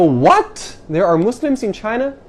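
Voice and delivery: loud, high disbelieving "What?"; retold surprise. Retelling how people react on hearing he's from China, shocked and high. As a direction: gasp it out like you can't believe it. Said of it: "And they go".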